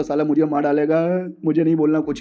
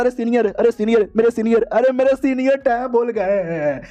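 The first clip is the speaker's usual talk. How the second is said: mock wailing; 6 semitones higher than usual. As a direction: wail it like a fake mourner. Junior wails over dead senior.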